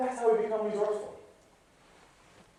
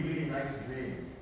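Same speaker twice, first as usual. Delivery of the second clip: normal talking voice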